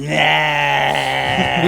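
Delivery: drawn-out imitation of a yawning dog. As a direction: stretch the word into a long dog yawn. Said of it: "it's like he's going".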